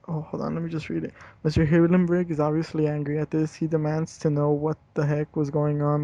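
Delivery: ordinary delivery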